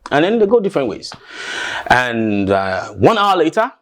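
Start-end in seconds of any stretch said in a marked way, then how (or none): none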